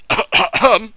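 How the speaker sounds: loud, high theatrical ahem; throat clearing. Pretend. About to read out a viewer's question, pointed ahem.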